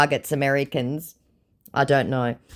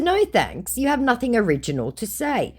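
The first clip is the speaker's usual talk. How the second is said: snooty high voice; 10 semitones higher than usual. Retold or imitated. Her own retort, acted.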